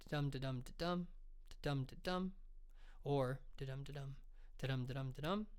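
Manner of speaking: da-dum da-dum with the second beat stressed; iambic beat. Shown as a demonstration. Teacher demonstrating iambic pentameter to writers, stressing each dum. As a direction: tap out the iambic rhythm on da-dum. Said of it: "the classic iambic pentameter".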